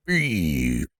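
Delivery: low gravel growl on one word; gravelly voice. Shown as a demonstration. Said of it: "Can we get a gravelly voiced beef?".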